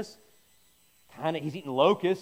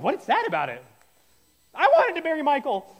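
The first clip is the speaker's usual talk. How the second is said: much higher, louder joking cry; mock outburst; 9 semitones higher, 7 dB louder than usual. Joking wedding guest blurting about Michael, loud and high. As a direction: blurt it out as a joke.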